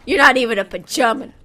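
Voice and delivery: quick, loud little insult; rapid teasing taunt; fast jab. Real, not acted. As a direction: fire it off quickly. Insult repeated as whisper.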